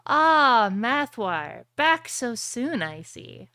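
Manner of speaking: smiling witch. Acted. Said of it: "she smiles at your entrance".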